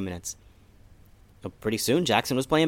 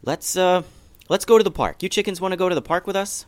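higher, louder, brisk mom; rally the chickens for the park; eager mom suggesting an outing; cheerful invitation; 8 semitones higher, 5 dB louder than usual. Acted.

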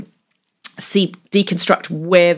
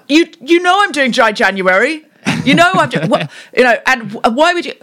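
high, exasperated and louder; blocking partner; 7 semitones higher, 4 dB louder than usual. Shown as a demonstration. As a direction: shoot down the beer idea in outrage. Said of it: "really kill their idea".

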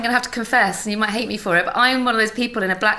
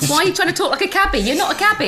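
quick, higher mock telling-off; exasperated husband; 8 semitones higher, faster than usual. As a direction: imitate a husband's incredulous nagging. Imitates husband scolding her accent.

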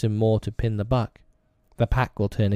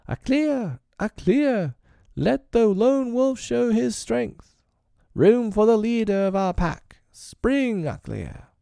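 much higher wild yelling wolves, slow in the middle; howling wolf pack; slower than usual. A narrator's reading. Young wolves jeer their failing leader, high wild howling. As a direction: howl it out like young wolves on the hunt. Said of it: "the yell of the pack hunting".

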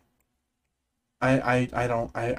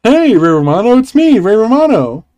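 hammy Ray Romano; 3 semitones higher than usual. Pretend. Improvised Ray Romano role-play.

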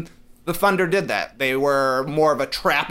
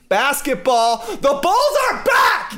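excited, high drawn-out shout; hyped proclamation; 8 semitones higher, 4 dB louder, slower than usual. Real, not acted. Host hyping the Bulls' return to his co-hosts, excited shout. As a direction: proclaim it loud and high, stretching the words.

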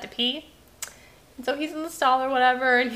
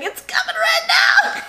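put-on high, loud child shout; shrieky little-boy impression at full volume; Michael; 6 dB louder than usual. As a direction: imitate a kid yelling urgent news. Voices child shouting from the stall.

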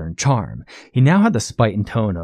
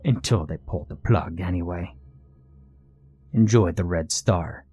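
understated gloating threat; taunting villain; 2 semitones lower, 4 dB quieter than usual. Acted. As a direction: taunt him with mock reassurance. Villain taunts Blaine in story.

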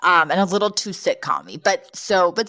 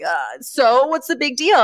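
put-on higher, slow nervous boy's voice; a kid faking calm, getting worried; 7 semitones higher, slower than usual. Worried boy trying to act cool.

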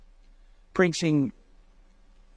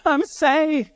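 higher and louder, I'm saved broken by a joyful laugh; 10 semitones higher, 4 dB louder than usual. Retold or imitated. Dying man bursts out, saved.

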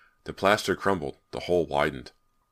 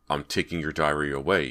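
ordinary delivery, nothing marked

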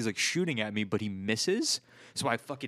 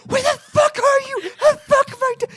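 put-on heated kid; 11 dB louder than usual. Player imitates the kid being sniped from the pier, heated screaming. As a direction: shriek like a kid losing it. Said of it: "He was getting heated".

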